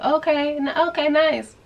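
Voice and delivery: high, airy okay, nice; distracted polite reply. Narrator answers the boy's small talk in a high, distracted okay. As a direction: humor him without listening.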